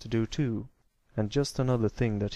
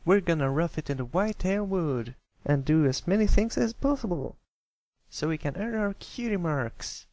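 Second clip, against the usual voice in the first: declared; 7 semitones higher than usual. Acted. Filly declares their camping plans, eager and higher. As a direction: announce the adventure with gusto. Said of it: "Apple Bloom declared".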